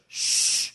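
long, forceful "shh"; drawn-out hushing noise; shush. Acted. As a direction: shush them for church. Shushing, mimicking quiet church.

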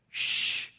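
drawn-out "shh" for silence; firm shushing noise; long shush. Acted. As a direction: hush the room with a long shh. Shushing the congregation.

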